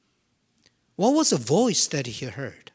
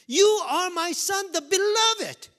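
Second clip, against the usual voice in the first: voice from heaven; 12 semitones higher, 5 dB louder than usual. Acted. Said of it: "That is the voice he heard".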